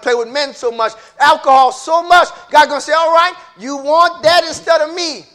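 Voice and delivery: high, loud fiery sermon; preaching at full force. Acted. Preacher calling sinners to judgment, loud and high. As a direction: build the preaching to a roar.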